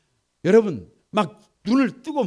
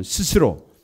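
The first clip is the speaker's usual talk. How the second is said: loud unison reading; 12 dB louder, slower than usual. Real, not acted. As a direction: shout the verse out together, louder. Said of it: "다 같이 한번".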